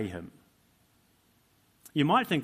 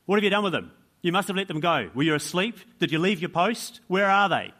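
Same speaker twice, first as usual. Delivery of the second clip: loud, higher, rapid-fire accusing questions; quizzing interrogation; 5 semitones higher, 6 dB louder than usual. Acted. Religious leaders grilling the prison guards, loud and accusing. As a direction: demand answers like an angry interrogator. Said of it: "maybe they would have quizzed the prison guards".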